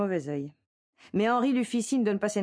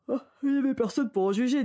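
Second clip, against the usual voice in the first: yawning; 7 semitones higher than usual. A narrator's reading. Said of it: "dit le barman en bâillant".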